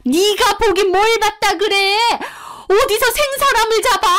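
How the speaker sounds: furious teacher pushing back; fierce accusing. Retold or imitated. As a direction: turn on the kid, cornering her. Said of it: "엄청 무섭게 몰아붙이고 따지는".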